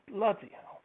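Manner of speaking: loud sudden curse; bloody hell at the mess; exasperated outburst. Real, not acted. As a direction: blurt it out, fed up. Exasperated at hidden coins.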